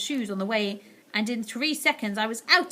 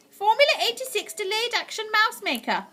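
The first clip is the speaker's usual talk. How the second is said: put-on higher old lady voice; grandmother reading label; 12 semitones higher, 4 dB louder than usual. A narrator's reading. Grandmother reading the bottle label aloud, careful. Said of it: "she read".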